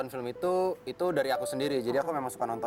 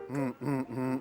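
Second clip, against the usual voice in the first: phone buzz hum; 3 semitones lower, 4 dB quieter, much slower than usual. Shown as a demonstration.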